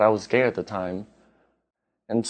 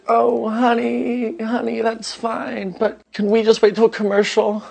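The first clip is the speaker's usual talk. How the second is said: imitating mom; 13 semitones higher, 3 dB louder than usual. Son voices his mom.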